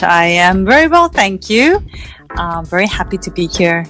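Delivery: ordinary talk, nothing marked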